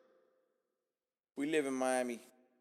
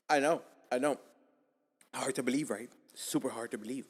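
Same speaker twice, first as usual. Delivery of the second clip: ordinary talk, nothing marked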